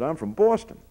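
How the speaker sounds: put-on a Boston claim in a thick New York accent. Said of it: "in an unmistakable New York accent".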